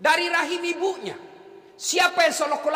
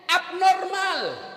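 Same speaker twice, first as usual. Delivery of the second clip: slow punchline; 4 semitones higher, much slower than usual. Real, not acted. Punchline drawn out.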